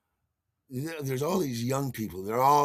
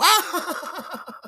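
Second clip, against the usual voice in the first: high, loud "ah!"; imitated delighted cry; 6 dB louder than usual. Dancers reacting to the turnaround, imitated high cry. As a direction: mimic a group's excited squeal.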